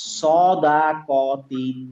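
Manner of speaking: সদাকতিন with lips rounded, স short and dark; very slowly say সদাকতিন with a round mouth; rounded Sad. Shown as a demonstration. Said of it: "সদ বলবো আমার মুখটা গোল হয়ে যাবে".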